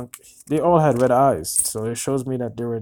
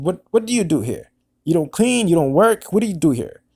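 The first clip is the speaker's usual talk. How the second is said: mock yelling; 5 semitones higher, 5 dB louder than usual. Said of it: "cursing at me".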